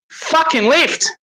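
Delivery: high, slow, drawn-out demand; frustrated plea. Real, not acted. Fan at the screen begging his team, high frustrated plea. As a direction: beg the team to lift, fed up.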